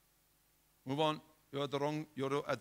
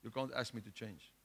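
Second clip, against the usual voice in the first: scorpion's quiet reply; 4 semitones lower, 7 dB quieter than usual. Acted. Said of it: "He says".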